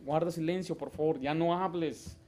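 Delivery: soft, courteous request for silence; quiet, patient parental correction; polite request. Shown as a demonstration. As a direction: ask a child gently to be quiet. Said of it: "Se les habla como se les debe hablar".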